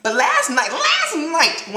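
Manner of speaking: giddy, climbing squeal of excitement; excited laughing build-up. Real, not acted. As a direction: gush about last night through laughter. Building excitement about the dream.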